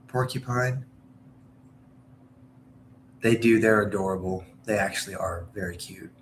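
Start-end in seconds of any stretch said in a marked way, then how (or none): 0.1-0.8 porcupine with a clear "kyu" in the middle; trying out pronunciation. Shown as a demonstration. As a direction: test porcupine as "por-kyu-pine". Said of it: "how do I say it?".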